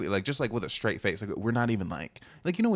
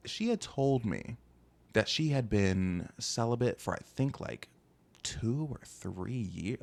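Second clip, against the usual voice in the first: slow dramatic pause; much slower than usual. Real, not acted.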